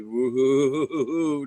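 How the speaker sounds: sliding woo-hoo-hoo down; wavy woo-hoo. Shown as a demonstration. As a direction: sing it like riding a wave down. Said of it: "down the other side".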